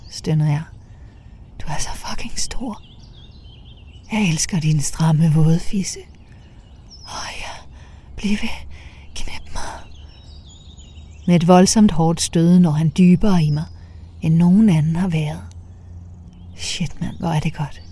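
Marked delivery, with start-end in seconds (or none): 1.6-9.6 intimate whisper, much softer; whispered pleas during lovemaking. Acted. 16.6-17.8 hushed whisper. Acted. Pleasure during sex.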